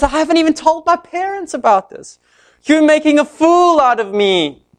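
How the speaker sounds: high, put-upon protest; exasperated Samson. Retold or imitated. Samson pleading with his nagging bride, exasperated. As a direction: whine that she is shaming you.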